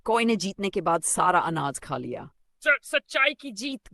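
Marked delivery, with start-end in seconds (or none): 2.6-3.9 high, loud, slow crow gloat. Acted. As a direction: crow over the win. Crow gloats after winning.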